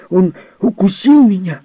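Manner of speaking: high, loud, furious cry; a boy yelling in outrage; angry child shout. Acted. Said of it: "крикнул Джерри". (высокий громкий детский крик)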